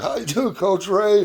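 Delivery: high-pitched, delighted hello; happy greeting. Retold or imitated. Parent meeting old coach at the park, acted happy greeting. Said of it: "I was so happy to see him".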